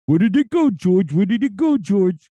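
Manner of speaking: high, loud cartoon vulture. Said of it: "trying to get Vulture's voice down".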